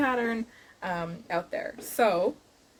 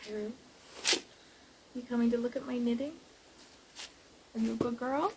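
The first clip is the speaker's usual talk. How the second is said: talking to a dog; 4 semitones higher, 4 dB quieter, faster than usual. Real, not acted. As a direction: coo at the dog like a pet parent. Speaking to her dog during knitting.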